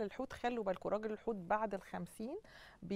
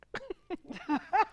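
high-pitched burst of laughter; real laugh; 9 semitones higher than usual. Real, not acted. Guest amused by a viewer's husband's message, laughing.

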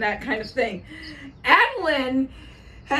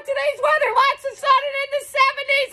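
higher, raised voice over a second talker; talking louder; 10 semitones higher, faster than usual. Real, not acted. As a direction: push the weather out over the chatter. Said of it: "I gotta talk louder than Natalie".

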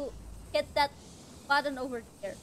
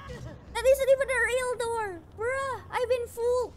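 high, loud wail of disbelief; indignant dismay; 6 semitones higher, 4 dB louder than usual. Real, not acted. Player fooled by a fake door, wailing indignantly. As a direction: whine at being tricked.

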